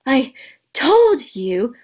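slow, broken gasp for breath. Acted. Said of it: "Hermione gasped".

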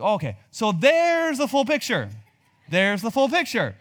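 loud, high mock realisation; like a dad finally getting it; dad catching on. Dad voiced in family story.

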